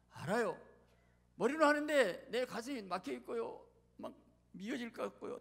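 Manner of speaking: high, pleading believer's complaint; acted anguished protest. Hurt believer protests to God that the heart is blocked, pleading.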